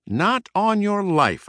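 high, drawn-out shriek; slow, high-pitched outburst of refusal. A narrator's reading. Mouse refuses cousin's expedition, high drawn-out shriek. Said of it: "I shrieked".

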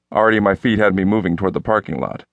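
ordinary delivery, nothing marked

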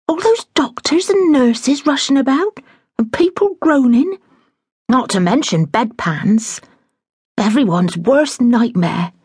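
lightly voiced flustered housekeeper; a hint of a fussing older woman in a fluster; fretful housekeeper. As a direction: housekeeper resolving to fetch her mother, flustered.

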